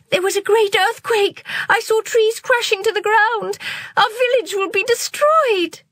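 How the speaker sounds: high, urgent panicked cry; frightened cry. Acted. Girl warning friends the village will fall, high and panicked. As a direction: warn them in panic. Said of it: "cried Amrita".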